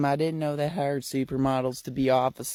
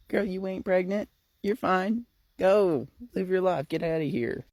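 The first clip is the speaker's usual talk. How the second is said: voicing Mama Weeks; 6 semitones higher than usual. Wise older woman reassuring friend.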